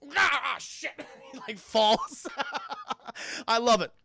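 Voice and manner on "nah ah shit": stumbling yelp. Retold or imitated. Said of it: "He's like".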